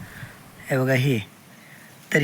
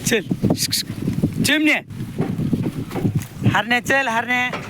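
drawn-out high call, then a sharp higher shout of the name; calling the animals; 6 semitones higher, slower than usual. Real, not acted. Farmer urges the calves behind the bullock cart, high drawn-out calls. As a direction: call them along the path.

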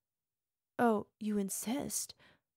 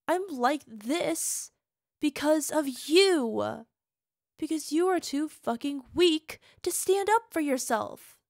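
angry outburst; 3 semitones higher, 7 dB louder than usual. Acted. Partner blaming her lover for her state, loud and angry. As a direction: throw the blame at them, loudly.

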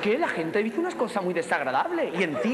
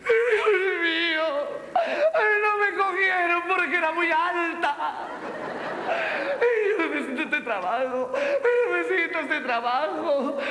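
high, slow, tearful lament; hammed-up wailing; 7 semitones higher, slower than usual. Rejected performer begging for the job, mock crying. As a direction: bawl it out theatrically, dragging the words. Acts wailing after failed audition.

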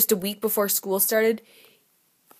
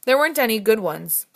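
usual speaking voice

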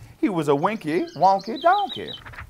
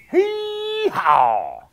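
high, stretched donkey bray; 3 dB louder than usual. Acted. Reader voices the donkey's bray.

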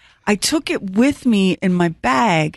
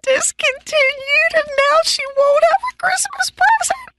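full-on crying wail. Retold or imitated. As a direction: wail it out in despair. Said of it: "now I'm full on".

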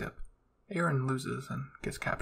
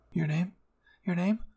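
rising alarm, calling her name louder and higher; worried calling; 4 semitones higher, 4 dB louder than usual. A narrator's reading. Mikasa calling the coughing girl, alarmed. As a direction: shake her awake with your voice. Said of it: "Mikasa said".

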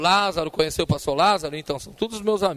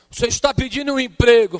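prophetic question; 7 semitones higher, 10 dB louder than usual. Retold or imitated. Missionary questions him, retold.

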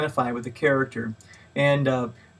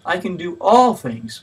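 "all" raised higher and louder, falling after; stress on ALL; 4 semitones higher, 6 dB louder, slower than usual. Shown as a demonstration. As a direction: lift and punch "all" to sum it up. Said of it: "each time placing a different emphasis".

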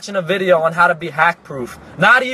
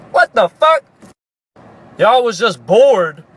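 disbelief; 4 dB louder, slower than usual. Retold or imitated. Disbelief at hackers' excuse.

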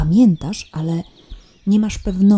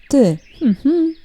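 ordinary delivery

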